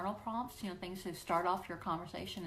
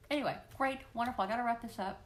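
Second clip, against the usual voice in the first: usual speaking voice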